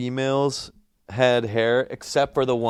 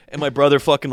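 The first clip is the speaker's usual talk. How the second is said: ordinary talk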